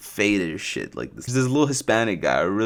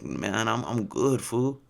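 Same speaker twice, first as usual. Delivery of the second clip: Chris; 5 dB quieter, much slower than usual. Retold or imitated. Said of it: "I'm not just doing this accent".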